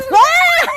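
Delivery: high, loud squeal; sudden high yelp; playful shriek. Real, not acted. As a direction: shriek as the ball comes at you. Shriek during kick game.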